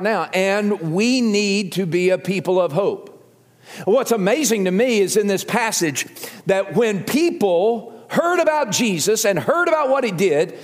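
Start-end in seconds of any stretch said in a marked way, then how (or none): 3.8-10.6 high, urgent preacher's delivery; impassioned preaching. Real, not acted. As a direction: preach it with excited conviction.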